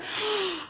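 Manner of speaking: high, sharp gasp of disbelief; shocked gasp. Real, not acted. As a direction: gasp after the scream. Shocked by her score.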